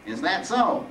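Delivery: hint at a trick up your sleeve; sly. Acted. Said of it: "as if he had more than one trick up his sleeve".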